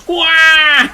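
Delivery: loud, high crying shout; child's wail. Retold or imitated. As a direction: wail like a startled little kid. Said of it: "one kid ... somewhere go".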